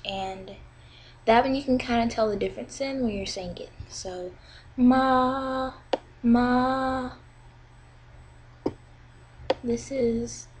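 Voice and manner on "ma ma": "ma" drawn out high and level; high flat tone. Shown as a demonstration. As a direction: hold "ma" steady like a sung note. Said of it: "very high-pitched".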